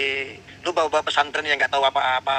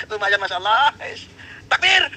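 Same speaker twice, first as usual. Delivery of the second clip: gleeful cheer; 8 semitones higher than usual. Real, not acted. Mocking host cheering after the payment arrives. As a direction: crow with delight over the money.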